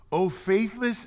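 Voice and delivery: high, loud exasperated rebuke; rebuking Jesus. Acted. Preacher voicing Jesus rebuking the disciples, exasperated. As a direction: cry it out in frustration.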